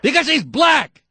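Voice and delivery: yelled high and loud, slowing down; furious shout. Real, not acted. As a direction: bellow it in outrage. Host explodes at racist caller.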